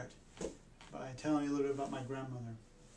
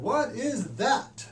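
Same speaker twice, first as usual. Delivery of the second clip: put-on high, loud, slow kid question; slow, high and loud innocent child's question; loud curious child; 10 semitones higher, 8 dB louder, much slower than usual. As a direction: hold it up and ask innocently. Kid showing stick to relatives.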